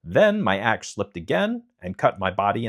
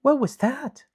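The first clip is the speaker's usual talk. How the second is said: high, alarmed little question; a sudden fright at a strange sound; Dorothy startled in the dark forest, asking high and alarmed. A narrator's reading. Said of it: "Dorothy heard a strange sound and asked".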